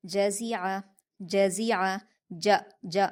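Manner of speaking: ज, ज said apart, a plain ज then a hissing ज़; separate jeem and za. Shown as a demonstration. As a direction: contrast ज and ज़ clearly in जज़िया. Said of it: "जीम और जा के मखरज को आप अलग-अलग".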